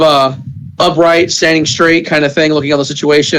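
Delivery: ordinary delivery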